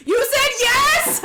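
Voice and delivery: very high, slow, delighted disbelief; hammed-up amazement. Boyfriend hearing his proposal was accepted, high mock amazement. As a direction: squeal it slowly, astonished.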